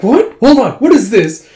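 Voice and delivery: loud high gasp of surprise; shocked outburst. Retold or imitated. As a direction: yelp in disbelief at the news. Friend discovers a secret romance.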